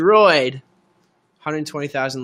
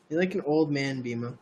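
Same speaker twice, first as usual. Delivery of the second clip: ordinary talk, nothing marked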